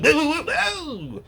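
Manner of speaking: put-on high, slow, wobbly old man's whoa; startled old man. Mocking the old man swerving his bike, high startled whoa. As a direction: squeal like a panicked old cyclist losing balance. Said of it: "he's just like".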